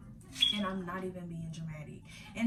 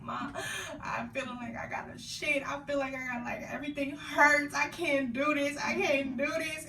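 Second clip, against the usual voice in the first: higher, pleading whine; retold panicked crying; 6 semitones higher than usual. Daughter in labor crying to her mother on the phone. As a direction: replay the panic to mom. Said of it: "on the phone with my mama and I'm like".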